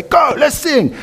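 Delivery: high-pitched rousing cry to start singing; excited, high call to a group; rallying call. Acted. Rallying children to sing loudly.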